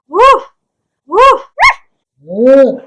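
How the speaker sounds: high, loud excited whoop; squealing woo of delight; fangirl whoop. Retold or imitated. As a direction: cheer like she can't contain herself. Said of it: "I'm like".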